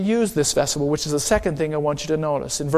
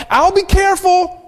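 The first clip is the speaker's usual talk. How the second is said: put-on much higher, louder boyish holler; hollered back; 12 semitones higher, 6 dB louder than usual. Jimmy hollers back to Aretta while heading for the steps, high boyish shout. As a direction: shout it back over your shoulder like a kid. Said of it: "he hollered back".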